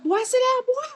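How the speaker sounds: high, loud Southern scold. Retold or imitated. Teacher's aide scolding kids in her Southern accent. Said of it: "And I'm like".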